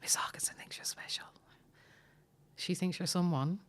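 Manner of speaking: soft whispered inner voice; little whisper. Retold or imitated. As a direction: breathe it like a secret thought. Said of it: "this other little whisper of".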